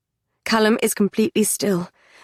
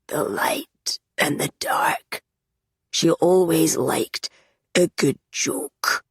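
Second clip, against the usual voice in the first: slow, rasping near-whisper; low and raspy; 2 semitones lower, slower than usual. Acted. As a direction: drag it out low and hoarse. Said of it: "His voice is low and raspy".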